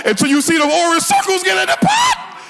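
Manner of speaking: high-pitched worked-up insistence; mock exasperation. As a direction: plead your absurd case at the top of your range. Mock-exasperated defense of his cooking.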